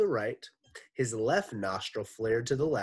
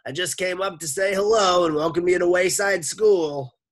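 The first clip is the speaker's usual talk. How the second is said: Missus Mush voice; 10 semitones higher, 12 dB louder than usual. Acted. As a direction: call through the door.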